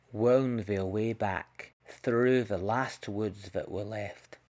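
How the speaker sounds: plain reading voice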